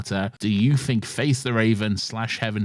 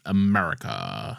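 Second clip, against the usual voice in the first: slow, lowered fake Mandarin "America."; 7 semitones lower than usual. Slipping back into the fake Mandarin, a slow low "America.". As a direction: drawl "America." in the mock Mandarin villain accent. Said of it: "fake Mandarin".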